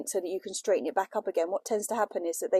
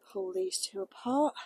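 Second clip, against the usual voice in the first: ordinary delivery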